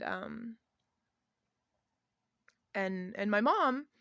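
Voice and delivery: usual speaking voice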